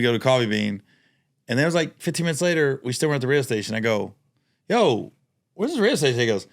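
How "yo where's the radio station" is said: confused question. Retold or imitated. Retelling own confused question.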